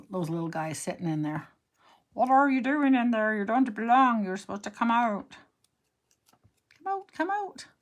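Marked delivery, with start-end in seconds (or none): 2.1-7.7 playful scolding. Pretend. Scolding stamps to come out.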